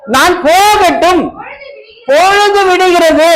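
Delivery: preacher reads the angel's plea aloud, slow and solemn; reading the angel's words. A narrator's reading. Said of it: "our".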